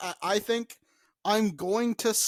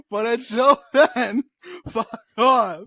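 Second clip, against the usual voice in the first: laughing; 8 dB louder than usual. Real, not acted. Laughing retort.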